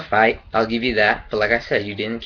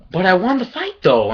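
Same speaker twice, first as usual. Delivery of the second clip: mock cocky retort; 7 semitones higher, 4 dB louder than usual. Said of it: "Broner was all like".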